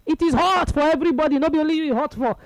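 high-pitched mock scolding; scolding wife. Wife telling off her complaining husband mid-match, scolding. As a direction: scold as an exasperated wife would. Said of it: "She scolded him".

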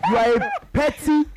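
high, loud playful taunt; mock the caller loudly; loud teasing. Real, not acted. Teasing the rival fan.

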